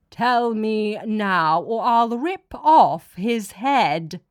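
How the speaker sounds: slow, loud menacing god; menacing threat. Acted. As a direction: threaten each word slowly. God threatens to kill professor.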